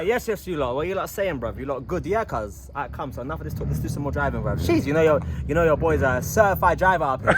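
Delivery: London roadman slang voice; slangy London street accent. Pretend. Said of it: "your roadman voice".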